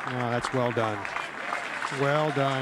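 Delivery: loud, delighted praise. Real, not acted. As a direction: call it out with delight. Reacting to a holed bunker shot.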